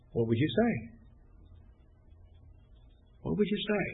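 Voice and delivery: soft, unhurried question with long pauses; gentle probing question. Acted. Preacher asking someone about dying and facing God, gently probing. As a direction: ask it quietly, leaving room to think.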